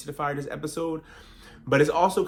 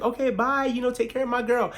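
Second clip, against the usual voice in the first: mock sweet Gizelle; 9 semitones higher than usual. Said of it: "she's like".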